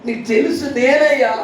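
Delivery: a hammed-up plea from a woman locked outside; pitiful begging. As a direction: beg him to recognise her. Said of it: "ఇలా ఎంత దీనంగా అడుగుతుంది".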